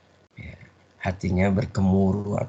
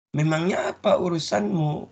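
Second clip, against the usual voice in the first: higher, curt "what's it to you" retort; defensive retort; 8 semitones higher than usual. Retold or imitated. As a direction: push back at a nosy question. Yazid snaps back at questioner. (balasan ketus bernada tinggi)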